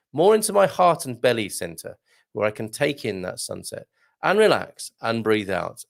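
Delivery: normal talking voice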